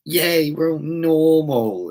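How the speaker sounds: high, drawn-out sarcastic cheer; mock cheering. Rant mimicking smug normal people, mock cheering. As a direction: crow it slowly in mock celebration.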